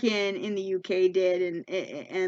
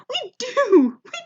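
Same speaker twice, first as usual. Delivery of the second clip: very high, mock crowd holler; hammed-up heckle from the audience; yelling back; 14 semitones higher, 4 dB louder than usual. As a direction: yell back delightedly in a far higher voice. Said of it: "People are yelling back".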